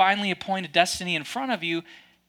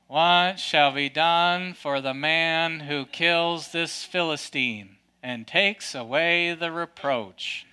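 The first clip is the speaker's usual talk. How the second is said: low, slow, meek and hesitant; timid reading; 5 semitones lower, 3 dB quieter, much slower than usual. Shown as a demonstration. Preacher mocking how David usually gets read, timid and slow. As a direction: read it like a nervous mouse asking permission. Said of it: "it kinda sounds like".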